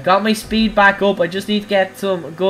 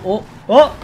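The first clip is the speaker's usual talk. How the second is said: ordinary talk, nothing marked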